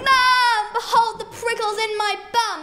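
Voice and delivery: loud, high, panicky call with a laugh; shouting to mum. Acted. Child yelling to mother about prickles, loud and distressed. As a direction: yell it across the house to mum. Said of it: "I ran home and shouted".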